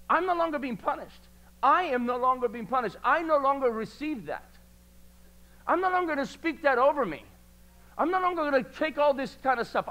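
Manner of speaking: rising declarations, gathering force; preaching. Acted. Preacher to church, building declaration of freedom.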